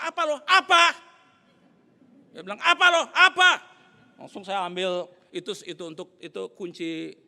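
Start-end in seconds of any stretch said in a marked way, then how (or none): 0.0-0.8 retold yell building to very loud and high; angry shouting match. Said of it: "ini emosi lagi naik". 2.6-3.5 retold yell building to very loud and high; angry shouting match. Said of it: "ini emosi lagi naik".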